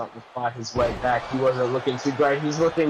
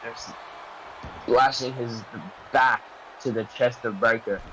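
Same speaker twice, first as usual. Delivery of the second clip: wrestling commentator. Acted. Live play-by-play commentary.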